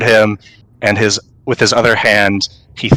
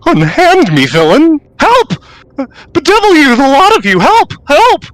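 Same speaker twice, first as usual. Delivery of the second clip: shrieking, high, loud damsel cries for help; overwrought stagey melodrama; 4 dB louder than usual. Acted. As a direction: ham up a captive's screams. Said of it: "you hear".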